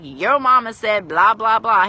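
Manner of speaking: loud, drawn-out playground jeer; acted bully's slow taunt; mock bully taunt. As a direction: drag out the insult loudly, mocking. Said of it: "and someone's like".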